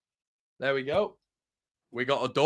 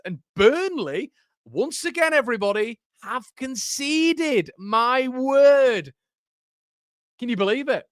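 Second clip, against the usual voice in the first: high, drawn-out triumphant announcing; gleeful goal call; 11 semitones higher, slower than usual. Real, not acted. Streamer telling chat Burnley conceded again, high gleeful drawl. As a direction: savour every word of their misery.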